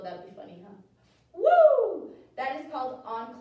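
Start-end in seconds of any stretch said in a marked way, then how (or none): 0.0-0.7 whispered joke. Real, not acted. Quiet joke aside. 1.3-2.1 loud high whoop; loud cheer. Real, not acted.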